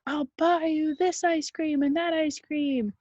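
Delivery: high mock coaxing grown-up voice; sweetly bribe a scared kid, voiced up high. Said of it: "They were like".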